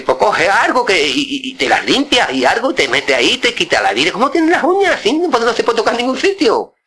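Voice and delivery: mock scolding, pitch way up; exasperated uncle fussing over dirty nails, high voice.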